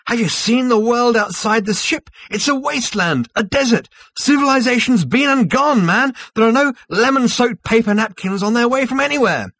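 high, very loud indignant rant; outraged shouting. A narrator's reading. Zaphod rants at the autopilot about the dead world, outraged shouting. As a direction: shout it at full pitch, appalled. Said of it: "he cried".

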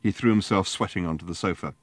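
plain reading voice

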